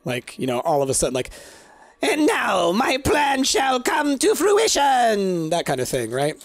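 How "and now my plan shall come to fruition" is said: slow, high, booming villain shout; eighties cartoon villain. Shown as a demonstration. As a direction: proclaim it like a Saturday morning cartoon villain. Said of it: "Like a villain from, like, a nineteen eighties Saturday morning cartoon".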